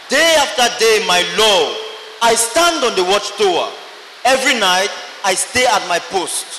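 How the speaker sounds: normal talking voice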